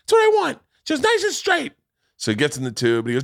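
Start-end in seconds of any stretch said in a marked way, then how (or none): 0.0-1.7 imitated high-pitched insistent yell; old school Bobby. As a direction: demand it in a hammed-up high yell. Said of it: "this is old school Boston Bobby".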